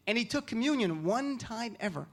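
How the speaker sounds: normal talking voice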